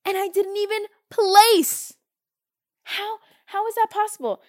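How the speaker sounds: can't believe she didn't place; surprised. Real, not acted. Surprised disbelief at not placing.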